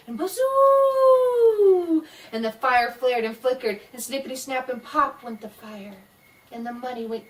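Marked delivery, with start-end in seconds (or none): 0.1-2.0 very high, loud, drawn-out wind howl. Acted. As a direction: moan like a gale through the trees. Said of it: "the wind blew".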